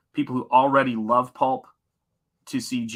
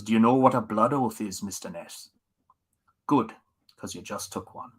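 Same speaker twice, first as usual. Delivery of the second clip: Sean Connery. Retold or imitated. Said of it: "the way he delivered it".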